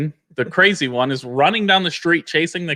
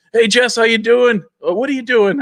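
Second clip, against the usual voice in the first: put-on higher, louder cheery drunk's greeting; friendly drunk; 10 semitones higher, 5 dB louder than usual. The drunk of Dundee greeting her, loud cheery drunk voice. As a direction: play the town drunk calling out hello. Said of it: "he's, like, the drunk of Dundee".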